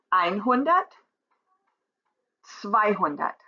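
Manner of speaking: einhundert, zweihundert with EIN and ZWEI hit hard; stress on first syllable. Shown as a demonstration. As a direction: open einhundert and zweihundert with a strong first beat. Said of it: "the first syllable being the one that is accentuated".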